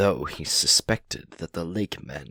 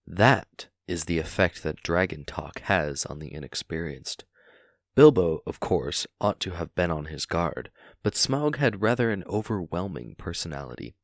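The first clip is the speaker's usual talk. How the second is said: usual speaking voice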